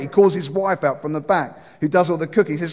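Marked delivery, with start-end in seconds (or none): none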